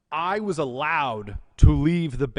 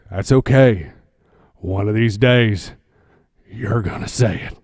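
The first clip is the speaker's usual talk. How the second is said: low knowing boss voice; lightly ominous, low and sure; boss promise; 7 semitones lower, 8 dB louder than usual. Retold or imitated. Boss promising he will crack, low and knowing.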